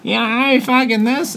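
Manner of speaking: lightly mocking voice of a chatty rugby fan; pushy rugby fan. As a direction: imitate an eager waiter who won't stop talking rugby. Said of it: "he's like".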